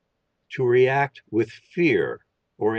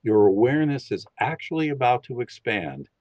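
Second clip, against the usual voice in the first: calm, steady guiding voice for a listening exercise; guided meditation. Acted. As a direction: lead them gently into listening. Leads a bell concentration meditation.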